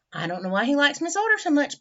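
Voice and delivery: plain reading voice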